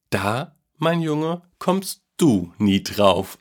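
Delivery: very slow, drawn-out grandpa tease, starting high; amused teasing. A narrator's reading. Grandpa keeps his secret from the boy, slow and playful. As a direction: draw each word out, lightly amused. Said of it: "antwortete Opa Werlin lachend".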